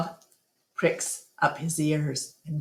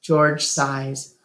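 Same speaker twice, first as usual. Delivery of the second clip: plain reading voice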